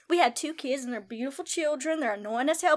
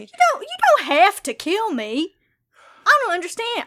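higher, louder desperate protest; upset protest; 6 semitones higher, 6 dB louder than usual. Acted. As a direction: push back, rattled and hurt. Wife protests being killed.

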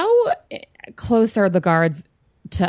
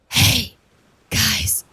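put-on British accent whisper; 3 semitones higher, 3 dB quieter, slower than usual. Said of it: "really hard for me to do a British accent and whisper".

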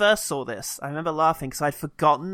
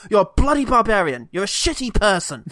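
lightly played uptight professor, pitched up and louder; mildly indignant middle-class Oxford don; a touch of a prissy English academic; 5 semitones higher, 5 dB louder than usual. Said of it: "this very uptight, middle-class Oxford professor".

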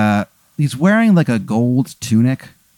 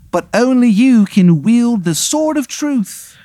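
high, grandiose fake English proclamation; Henry the eighth, bad English accent; 12 semitones higher, 3 dB louder than usual. King names the chosen one, in a bad English accent. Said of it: "it's a very bad English accent".

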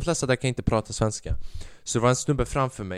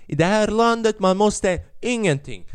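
put-on emphatic Italian accent; 10 semitones higher, 5 dB louder than usual. Italian cashier lecturing customer about the receipt, emphatic accented Swedish. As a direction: declare it with hammed-up Italian emphasis. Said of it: "han sa så mycket med den meningen".

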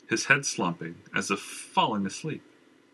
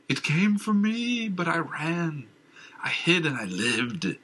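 raving madman; 7 semitones higher than usual. Acted. Madman raves, high and slow.

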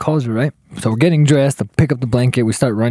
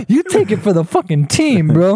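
high-pitched exasperated pleading; hammed-up indignation, voice pitched well up; mock mad; 7 semitones higher than usual. Guy telling friend to take one for the team, acting mad. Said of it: "I'm starting to get mad".

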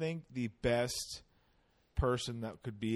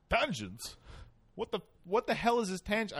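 put-on failed Russian accent; 7 semitones higher than usual. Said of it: "abandoning the Russian accent".

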